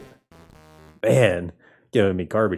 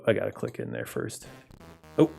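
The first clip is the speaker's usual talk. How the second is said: ordinary talk, nothing marked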